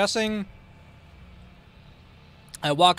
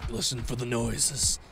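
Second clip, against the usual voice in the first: low, hushed reminder; 5 semitones lower, 6 dB quieter than usual. Acted. Killer listening for survivors, hushed reminder. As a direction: lower and softer, to oneself. Repeats the hint, first low.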